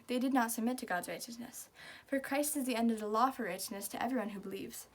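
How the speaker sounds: usual speaking voice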